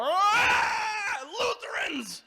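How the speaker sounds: high, loud drawn-out scream; exploding outburst. Acted. Midwesterner who can't take it anymore, screaming outburst. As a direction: let everything burst out in a comic shriek. Bottled-up feelings burst out.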